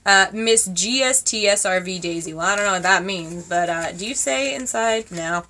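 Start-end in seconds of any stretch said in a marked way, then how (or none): none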